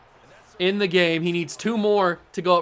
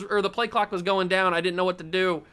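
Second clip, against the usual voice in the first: ordinary talk, nothing marked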